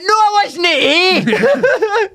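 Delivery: a put-on Scottish accent, getting angry; thick Scottish accent. Prank caller to restaurant, thick Scottish accent. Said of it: "put on a thick Scottish accent".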